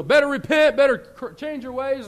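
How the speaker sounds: high, loud prophet's call; cried out warning. Retold or imitated. Jonah crying out to Nineveh, loud warning. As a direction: call the city to repent. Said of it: "cried out saying".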